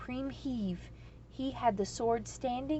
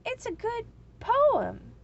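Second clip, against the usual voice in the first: loyally; 13 semitones higher, 7 dB louder than usual. A narrator's reading. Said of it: "said the cobbler's son loyally".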